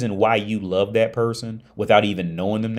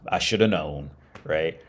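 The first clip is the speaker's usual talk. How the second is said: ordinary talk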